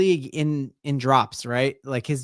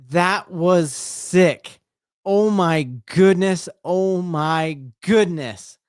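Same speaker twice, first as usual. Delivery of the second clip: slow, stretched-out amazement; real drawn-out fan disbelief; awestruck excitement; 5 semitones higher, slower than usual. Real, not acted. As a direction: marvel at the play, drawing the words out. Marvelling after the touchdown.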